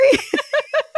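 sudden high laughing burst. Real, not acted. Laugh after retelling.

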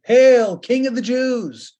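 high, loud jeering hail; mocking hail. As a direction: jeer it up at him like the crowd. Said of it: "they mocked him saying".

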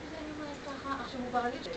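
ordinary talk

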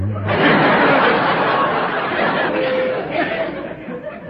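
a high, loud burst of laughter; bursting into a real laugh. Real, not acted. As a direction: caught off guard, laugh out loud. Guest laughs at the joke.

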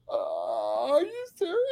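whimpering mimicry climbing to a tiny squeak; meek nicest voice. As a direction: imitate a stunned man close to tears. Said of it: "in the nicest voice".

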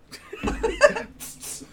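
breathless high wheezing laugh; wheezy laughing fit; silent wheeze laugh. Real, not acted.

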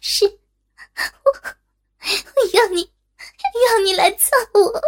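high, halting, coerced answer; tearful. A narrator's reading. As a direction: force the words out through tears. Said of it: "含泪回答".

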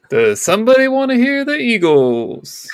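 slow, high, loud announcer; hammed-up radio DJ. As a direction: blast it out to the listeners, stretched. Playing a radio DJ.

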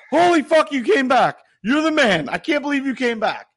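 high, loud ecstatic greeting; overjoyed dog. Acted. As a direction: gush like a dog whose owner is home. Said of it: "My dog's like".

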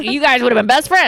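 high, loud goofy impression of the guy; mocking the guy's voice. Ex-boyfriend's friend gushing to her, in a high, loud mock voice. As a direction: ham up the man telling her they'd be best friends. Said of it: "He's like".

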